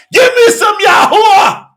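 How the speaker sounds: high, loud plea; fervent cry. Real, not acted. Preacher crying out for the living water.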